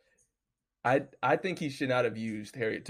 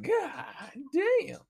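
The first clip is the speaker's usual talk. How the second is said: "God damn" jumping much higher in amazement, stretched out; shocked high god damn; 13 semitones higher than usual. Retold or imitated. Told the alimony total, a high astonished "God damn". Hearing the total amount paid.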